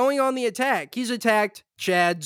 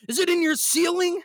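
mock panic; 10 semitones higher than usual. Mock warning to DeSantis.

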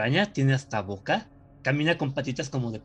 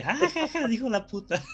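mock snicker; 9 semitones higher than usual. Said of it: "Y yo de".

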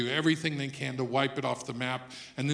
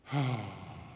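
long, falling sigh, lower; mock self-pitying sigh; 6 semitones lower than usual. Mock Jesus taking a moment of silence, woeful sigh. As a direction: let out a put-on woeful sigh.

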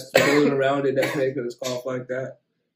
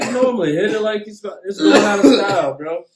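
higher and louder, egging on; excited urging; 7 semitones higher, 9 dB louder than usual. Real, not acted. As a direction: pump him up to rip it. Urging friend to hit it.

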